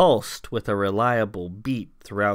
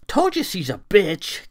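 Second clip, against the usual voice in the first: higher, louder smug jab; 8 semitones higher, 4 dB louder than usual. Acted. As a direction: gloat I-told-you-so. Clara smug.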